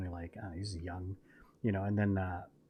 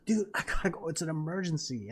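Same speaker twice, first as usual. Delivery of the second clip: high-pitched retold begging; desperate pleading; 6 semitones higher than usual. Customer telling refusing clerk it's an emergency, desperate pleading. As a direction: press the clerk, mock desperate.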